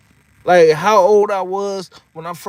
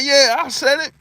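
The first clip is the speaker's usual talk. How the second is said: higher, laughing defiant brag; cocky Mike; 10 semitones higher, 3 dB louder than usual. Retold or imitated. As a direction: own it like Mike on TV. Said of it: "He's like".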